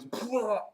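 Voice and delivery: high falling splat noise; imitated neck splat. Zombie's neck gives way, imitated splat sound. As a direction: mimic a squishy burst with the mouth. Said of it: "And the neck goes".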